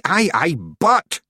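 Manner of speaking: loud, high desperate interjection. A narrator's reading. Rob trying to calm the wailing Feegles, desperate. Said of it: "said Rob Anybody desperately".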